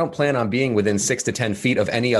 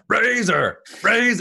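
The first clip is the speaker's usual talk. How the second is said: very high quarterback cadence call; mock quarterback barking the snap count; Russell Wilson; 13 semitones higher, slower than usual. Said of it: "practicing his pre-snap cadence in a mirror".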